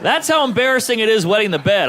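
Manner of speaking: ordinary delivery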